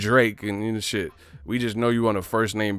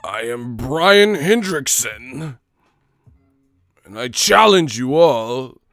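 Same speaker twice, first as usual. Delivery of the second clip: slow booming challenge, louder; mock grand challenge; 2 semitones higher, 7 dB louder, slower than usual. Streamer parodying another viewer's intro, slow and booming. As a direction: proclaim it like a duelist. Mocking grand self-announcement.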